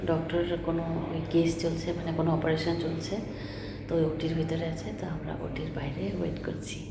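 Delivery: hushed hospital whisper. Real, not acted. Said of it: "আমি খুব আস্তে আস্তে কথা বলছি".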